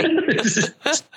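high burst of laughter; real laugh. Real, not acted. Storyteller cracks up at the bag plan, laughing. Laughs at own punch line.

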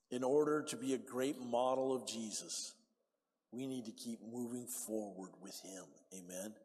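ordinary delivery

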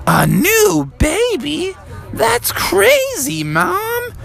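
put-on high, slow amazed kid; drawn-out high disbelief; Froggy. Froggy hears about the baby, high astonished voice. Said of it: "And Froggy said".

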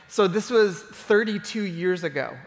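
usual speaking voice

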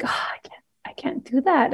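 hushed, near-whispered inner dismay; soft, breath-only retold reluctance; reluctant whisper. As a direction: whisper it to yourself, unable to go through with it. Retold pained reluctance, thought to self.

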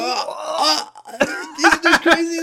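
high-pitched giddy shriek; excited Derek. Retold or imitated. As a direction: lose it with delight, laughing. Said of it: "He's like".

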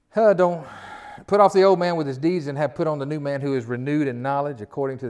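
loud, ringing Bible reading; forceful scripture reading. Acted. Preacher reading the verse to his church, loud and proclaiming.

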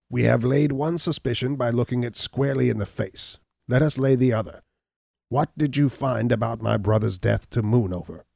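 a hint of a defiant hunchback pressing his case; Gall's voice. Narrator voices Gall.

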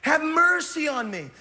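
high, slow, pleading cry. Acted. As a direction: beg from the roadside, top of your lungs. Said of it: "he begins to scream out".

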